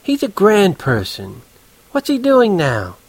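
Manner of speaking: high, slow, gushing woman; chatty, drawn-out feminine interest; friendly as hell. A narrator's reading. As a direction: turn on the charm suddenly. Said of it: "She was getting friendly as hell".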